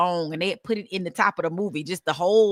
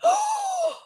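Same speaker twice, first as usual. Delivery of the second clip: high gasped oh of dawning horror; mock startled gasp; shocked oh. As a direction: squeak it out in sudden shock.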